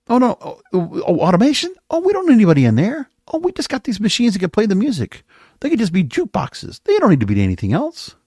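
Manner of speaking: high-pitched, sarcastic mock of bosses; mocking executives. Radio boss selling automation over live staff, mockingly airy. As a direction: imitate glib bosses, pitched well up.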